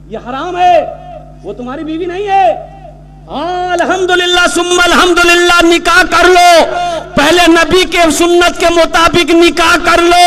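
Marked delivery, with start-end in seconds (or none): 3.3-10.3 high, ringing call to marry; soaring sermon cry; fervent preaching. Real, not acted. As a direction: preach it out with full fire. Preaching at full force.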